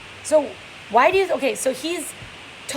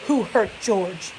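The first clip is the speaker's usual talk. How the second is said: demanded; much slower than usual. Acted. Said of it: "he demanded".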